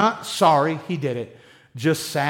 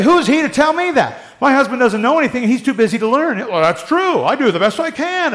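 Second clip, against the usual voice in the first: indignant wives; 6 semitones higher, 4 dB louder than usual. Retold or imitated. Imagined defensive wives.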